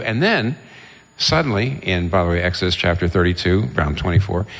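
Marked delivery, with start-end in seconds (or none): none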